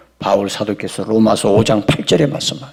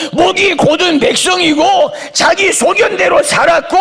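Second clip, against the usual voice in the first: shouted preaching. Acted. Preacher proclaiming God's love to the congregation, shouting high. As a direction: cry it out at the sermon's climax.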